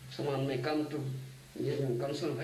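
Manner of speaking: ordinary talk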